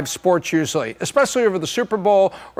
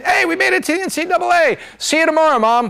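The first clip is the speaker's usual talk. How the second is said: mock excited cheer; 9 semitones higher, faster than usual. Mocking a low seed celebrating.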